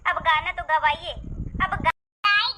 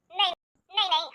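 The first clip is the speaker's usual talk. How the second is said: ordinary talk, nothing marked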